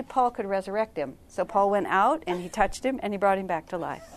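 ordinary talk, nothing marked

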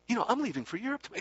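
high, rapid singsong imitation; retold Swami's inflection. Swami repeating his opener to the student, high identical inflection. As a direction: mimic his repeated opener, pitched right up and quick. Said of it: "Exactly the same inflection even".

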